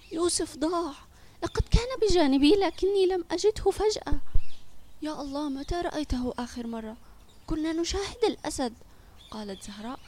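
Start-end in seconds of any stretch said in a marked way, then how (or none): 0.1-8.7 high-pitched, upset voice holding off crying; fighting back tears. A narrator's reading. Boy tells the mother her son is lost, fighting back tears. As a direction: tell it urgently, on the edge of tears. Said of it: "وقال وهو يقاوم بكاءه".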